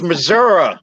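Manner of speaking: "Missouri" ending in an "uh" sound; local pronunciation. Shown as a demonstration. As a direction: say "Missouri" as muh-ZUR-uh. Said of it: "It is pronounced Missouri".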